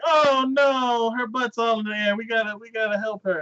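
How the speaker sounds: high wail, easing as it goes; hammed-up dismay; mock crowd panic. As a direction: fret like a helpless crowd. Fretting crowd, acted.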